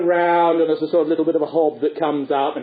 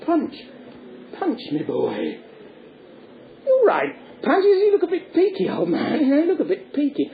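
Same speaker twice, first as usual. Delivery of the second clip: hearty puppet doctor; 9 semitones higher than usual. Acted. Doctor calls on fallen Punch, swooping mock-concerned voice. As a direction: check on a patient, hammed-up.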